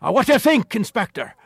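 imitated shouting interrogator, pitched very high; Jerry. Officer presenting a visiting inspector to a suspect, high mock shouting. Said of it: "I would hear him shouting".

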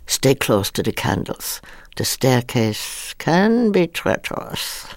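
slow, eerie warning, dragging every word; drawn-out creepy housekeeper warning; spooky line reading. Retold or imitated. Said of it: "And it was a line reading".

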